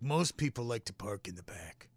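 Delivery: a low, quiet, matter-of-fact clerk; lightly voiced shop clerk, low and hushed; deadpan clerk. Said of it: "He's like".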